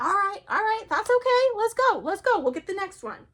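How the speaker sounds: very high, loud pep talk; rally them after a miss; encouraging cheer. Acted.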